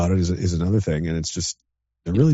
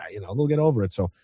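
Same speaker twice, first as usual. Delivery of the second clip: normal talking voice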